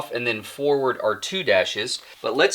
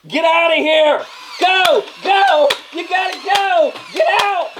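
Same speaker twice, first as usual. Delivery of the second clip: very high, urgent yells; over-the-top evacuation cries; mock panicked shouting; 3 dB louder, slower than usual. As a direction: scream at everyone to flee, hammed up.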